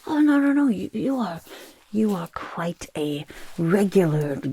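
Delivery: startled, apologetic protest; flustered reassurance. Acted. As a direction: rush to take it back before offence is taken. Hastily correcting an offended customer.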